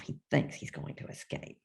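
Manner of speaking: usual speaking voice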